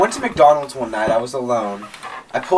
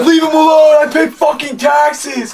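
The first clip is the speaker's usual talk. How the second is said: much higher, slow shouted rant; imitating an angry driver yelling; mock furious yell; 11 semitones higher, 5 dB louder, slower than usual.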